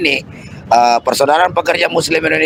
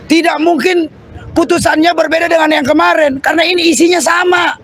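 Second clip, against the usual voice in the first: rousing protest oratory; rally the crowd like a revolutionary; fiery revolutionary speech; 12 semitones higher than usual. Acted.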